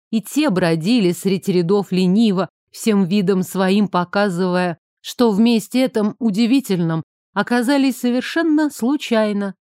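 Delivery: plain reading voice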